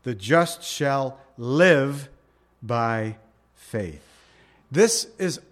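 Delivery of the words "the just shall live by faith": slow, live drawn out and stressed. Shown as a demonstration. Preacher reading the verse to his flock, stressing live. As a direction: hang on the word live. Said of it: "the emphasis is on live".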